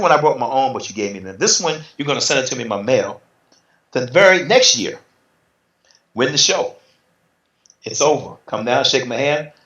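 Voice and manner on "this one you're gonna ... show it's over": usual speaking voice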